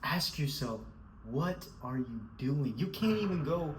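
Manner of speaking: ordinary talk, nothing marked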